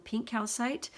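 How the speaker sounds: usual speaking voice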